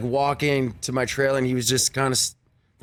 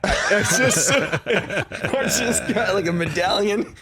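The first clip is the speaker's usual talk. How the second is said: laughing; 6 semitones higher, much slower than usual. Real, not acted. Amused at award story.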